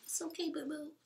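high, soft coaxing to the dog; soothing baby talk. Retold or imitated. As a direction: coo it like comforting a scared puppy. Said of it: "So I opened the bag and I'm like".

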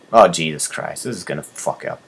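ordinary talk, nothing marked

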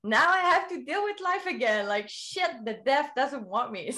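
high, exasperated groan at being alive; mock despair. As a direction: whine it like life cheated you. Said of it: "Yeah, like".

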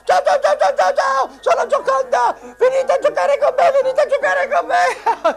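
very high giggling queen puppet; squeaky shrill puppet voice. Acted.

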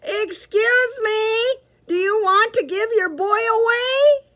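very high, loud, slow shout; polite shout. Acted. Petey shouting across the street, high and polite. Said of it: "shouted at the top of his lungs".

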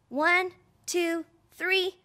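ordinary talk, nothing marked

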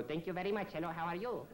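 high-pitched foreign immigrant voice. Shown as a demonstration. Showing how to do the foreign immigrant, high voice. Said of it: "is to make my voice higher".

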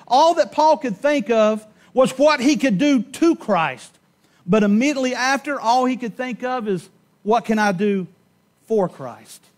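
loud, high-pitched pulpit preaching; fiery sermon delivery; full-force preaching. Acted. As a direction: preach it out to the whole church.

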